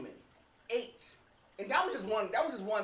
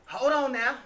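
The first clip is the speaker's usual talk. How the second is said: put-on nervous old man; 4 semitones higher, 6 dB louder than usual. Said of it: "He was like".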